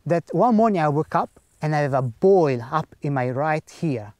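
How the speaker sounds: ordinary talk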